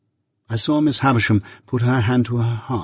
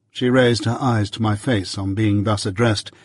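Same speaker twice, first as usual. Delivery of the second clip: plain reading voice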